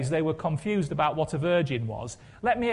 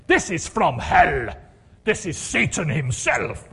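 louder booming evangelist preacher voice; Reinhard Bonnke impression; 7 dB louder than usual. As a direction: thunder the words like a fiery revival preacher. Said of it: "This is my Reinhard Bonnke, um, impression".

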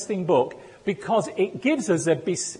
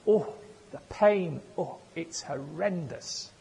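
mildly groaned complaint, fading away softly; imitated moaning sufferer; 8 dB quieter than usual. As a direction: play a woman lightly moaning about her aches. Imitates the bunion woman's moaning.

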